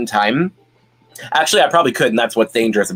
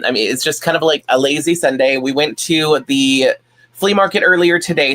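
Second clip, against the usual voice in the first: normal talking voice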